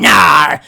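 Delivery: loud mock pirate arr; pirate growl. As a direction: shout a hammed-up pirate snarl. Going full pirate.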